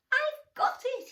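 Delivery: high, loud eureka squeak; excited mouse. Acted. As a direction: burst out with the idea as the mouse. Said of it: "he said".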